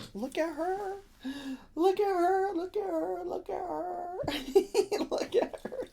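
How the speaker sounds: tiny, very high, soft squeal; squeaky cooing. Pretend. Streamer fawning over the new bag, squeaky cooing. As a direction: coo it over and over, fading into giggles.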